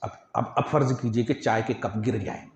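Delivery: ordinary talk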